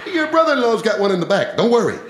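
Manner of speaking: a hint of the breezy in-law; father-in-law. Father-in-law brushing off the machine gun, lightly voiced. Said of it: "my father-in-law".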